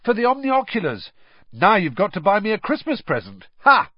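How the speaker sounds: high, loud, gleeful teasing shout; happy yell. A narrator's reading. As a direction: crow it out cheerfully. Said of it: "Ron yelled happily".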